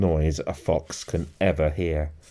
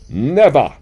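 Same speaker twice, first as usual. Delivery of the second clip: loud, high outburst; defiant cry; 9 semitones higher, 11 dB louder than usual. A narrator's reading. Mister Fox rejecting his wife's fear, loud and defiant. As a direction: refuse it forcefully.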